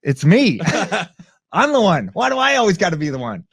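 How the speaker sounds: high, loud mock exasperation; exasperated self-talk. Bystander realizing he must step in, mock exasperated. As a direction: whine it like you're stuck again. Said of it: "I'm like".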